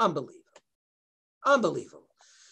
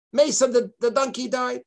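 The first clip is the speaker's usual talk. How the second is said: ordinary delivery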